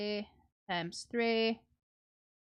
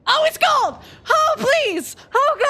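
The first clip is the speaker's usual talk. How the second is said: much higher, much louder thrilled yell; excited shout; 11 dB louder than usual. Real, not acted. Streamer sees a gold pull, a high shouted thrill. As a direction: scream with real excitement.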